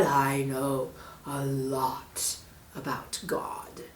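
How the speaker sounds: menacing snake. Acted. Snake hints at the monkey's fate, low. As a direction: let the threat hang quietly.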